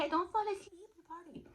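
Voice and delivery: high-pitched, badly faked Russian accent; terrible Russian accent. Russian guy nudging her awake, in bad Russian accent. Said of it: "that was a terrible Russian accent".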